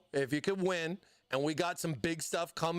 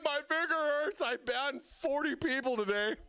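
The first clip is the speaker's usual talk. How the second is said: mod complaining voice; 6 semitones higher than usual. Pretend. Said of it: "It's like".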